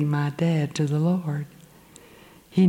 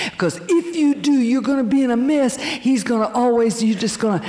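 much higher, wheedling warning of trouble; tempting Satan voice; 6 semitones higher than usual. Retold or imitated. Satan tempts her away from Christ, high and wheedling. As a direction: voice the devil nagging her, pitched up. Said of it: "It was Satan saying".

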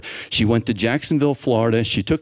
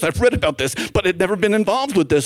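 acted eagerness in a higher pitch; excited; 8 semitones higher than usual. Pharmacist hears of a reversal she has read about, excited. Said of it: "I'm so excited".

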